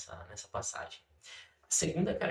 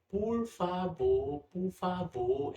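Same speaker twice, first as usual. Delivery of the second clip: put-on slow, drawn-out little-child plea; small child's long-drawn please; toddler pleading; 7 semitones higher, much slower than usual. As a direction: imitate a toddler begging sweetly, stretching each word. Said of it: "Ela fala desse jeito, do jeitinho dela".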